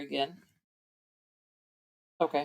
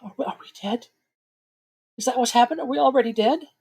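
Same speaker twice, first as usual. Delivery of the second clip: frightened woman; 7 semitones higher, faster than usual. Acted. Frightened NPC asks if dead.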